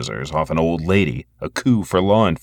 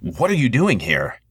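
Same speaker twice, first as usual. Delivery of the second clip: sharp; 4 semitones higher than usual. A narrator's reading. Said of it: "he must have sounded pretty sharp".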